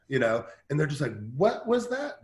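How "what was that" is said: much higher, surprised question; puzzled bystander. Retold or imitated. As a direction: imitate someone baffled by the call.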